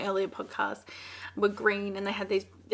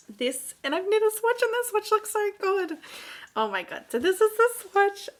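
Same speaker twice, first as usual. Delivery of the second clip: higher, louder, gushing delight; excited; 10 semitones higher, 5 dB louder than usual. Real, not acted. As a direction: squeal over the swatch with glee. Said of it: "I am so excited".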